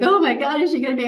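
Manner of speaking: mock teary panic; husband close to a breakdown, panicked and high. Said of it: "He's in tears".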